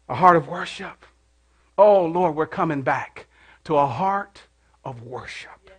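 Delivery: soft, slowing to a whisper; hushed worship. Acted. Pastor calling congregation back to worship, hushed. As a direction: let it fade into a whisper.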